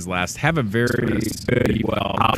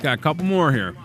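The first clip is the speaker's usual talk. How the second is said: talking with a mouth full; words round a mouthful of cheese curds; 5 semitones higher than usual. Real, not acted. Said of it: "I'm talking with my mouth full".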